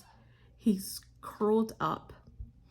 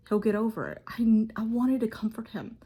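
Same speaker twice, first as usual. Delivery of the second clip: normal talking voice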